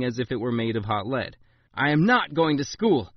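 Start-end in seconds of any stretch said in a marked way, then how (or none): 1.7-3.1 louder outburst, stress on not; a boy blurting defiance; blurted refusal. A narrator's reading. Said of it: "I blurted out".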